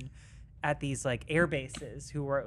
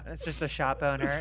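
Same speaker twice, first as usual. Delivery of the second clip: ordinary delivery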